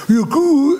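loud, high mock bellow; imitated Esau shout. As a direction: call out high in a crude put-on voice. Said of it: "והיה עושה". (צעקה מוגזמת בחיקוי של עשיו)

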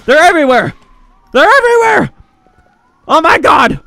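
high, screaming alarm; play-acted panic. Cat officer seeing clones everywhere, screaming panic. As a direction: yell in escalating hysterical bursts. Clones appear all around.

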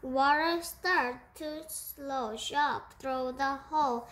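plain reading voice

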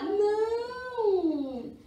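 high, drawn-out playful no; playful denial. Pretend. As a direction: answer like a chorus of children. Answers own question for children. (negação aguda, esticada e brincalhona)

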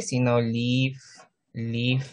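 ordinary talk

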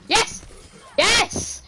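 high, sudden cheer of victory; celebratory high yell; triumphant shout. Real, not acted. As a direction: burst out with two high yeses. Celebrating a play in game.

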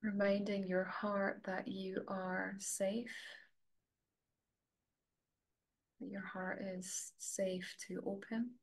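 soft, level voice with long pauses; guided meditation. Real, not acted. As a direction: gently guide them inward, leaving space to breathe.

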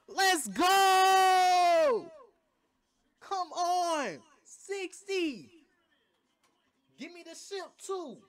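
high, loud victory scream; excited screaming. Real, not acted. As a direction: scream it out, celebrating a big score. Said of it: "I know I'm screaming a little too much".

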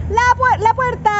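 high yelling for the door; high mock shout at the driver; retold yell. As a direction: call out to stop the bus. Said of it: "yo grité".

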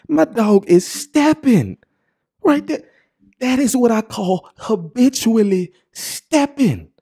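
high-pitched, drawn-out gushing praise; overjoyed for his friend; thrilled gushing. Real, not acted. Admiring his friend's smile, a high excited gush.

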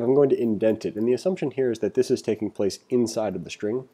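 ordinary talk

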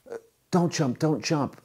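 high, urgent pleading; urgent plea. Acted. Rescuer to man on the ledge, urgent plea.

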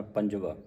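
ਪੰਜਵਾ said without nasalising the final vowel; without nasal ending. Shown as a demonstration. Teacher shows ਪੰਜਵਾ with no nasal ending to students. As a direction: end ਪੰਜਵਾ on a plain open aa. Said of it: "ਬਿੰਦੀ ਤੋਂ ਰਹਿਤ ਪੜ੍ਹਨਾ".

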